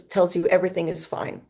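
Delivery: ordinary talk, nothing marked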